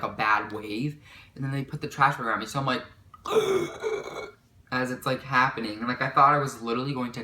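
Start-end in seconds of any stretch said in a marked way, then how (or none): 3.1-4.3 long, high strained intake of breath; acted suffocating gasp. Trash bag over her head, acted suffocating gasp.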